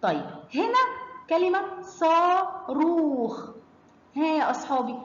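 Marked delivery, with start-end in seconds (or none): 1.3-3.5 slow, high كلمة صاروخ, both vowels in صاروخ held; long vowel demo. Shown as a demonstration. Teacher recaps the two madds, drawn-out صاروخ. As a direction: stretch saa and rookh in صاروخ. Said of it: "يعني فيها اتنين مد".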